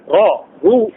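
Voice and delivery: را، رو with thick, heavy R. Shown as a demonstration. Teacher drills the vowels for students, heavy R in را، رو. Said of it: "تفخيم".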